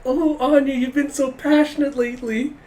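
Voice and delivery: put-on very high, girly coo; hammed-up admiring squeal; gushing teasing. Becky gushes at Anya.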